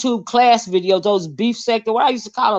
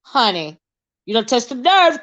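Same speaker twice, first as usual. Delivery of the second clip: ordinary talk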